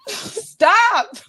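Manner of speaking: apologizing while laughing to tears; high, breaking with laughter, can't hold it in; real helpless laughter. Real, not acted. Cohost cracking up mid-apology, real tearful laughter. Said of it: "make me laugh harder".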